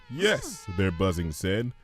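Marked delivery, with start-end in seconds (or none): 0.1-0.7 high buzzy little voice; bees' answer. Acted. Bees agreeing to help their friend, high.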